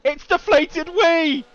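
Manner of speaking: slow, drawn-out loud shout; excited shout. Real, not acted. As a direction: yell the driver's name out. Crash spotted in race commentary.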